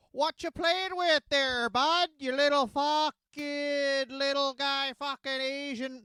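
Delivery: high, slow mocking Winnipeg bully; Canadian tough guy. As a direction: sneer at the kid like a small-town tough. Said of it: "imagine a young Tyson Smith, uh, growing up around guys like this".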